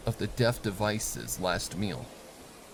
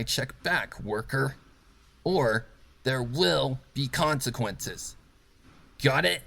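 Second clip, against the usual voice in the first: menacing boss; 5 semitones higher than usual. Acted. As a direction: lean on every word to intimidate. Rainbow Dash gives orders.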